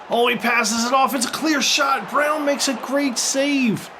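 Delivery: high and loud, calling the save; excited commentary shout. Real, not acted. Commentator calling a big save in his career game, excited shout. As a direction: yell it like the keeper just saved the match.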